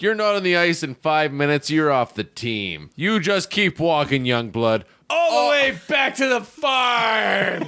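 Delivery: mock coach ordering the whiny rookie back to the farm; hard-ass coach yell. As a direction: bark it like a fed-up coach. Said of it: "Coach is like".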